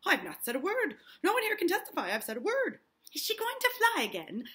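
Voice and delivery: high, loud, excited protest; indignant old man. Acted. Giles protests loudly, acted.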